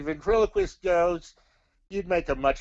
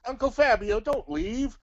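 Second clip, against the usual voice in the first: pleading call; 9 semitones higher than usual. Acted. Pleading as puppet leaves.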